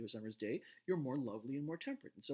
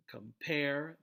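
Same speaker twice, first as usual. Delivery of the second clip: "Compare" with a louder, longer "pair"; stress on second syllable; 3 semitones higher, 6 dB louder than usual. Shown as a demonstration. Lecturer showing the stressed syllable, com-PAIR. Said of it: "the pair in compare is the".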